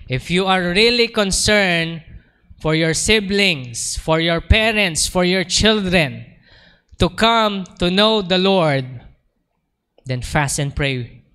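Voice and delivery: slow, loud preaching appeal. Acted. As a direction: press each phrase on the flock. (mabagal at malakas na pangangaral)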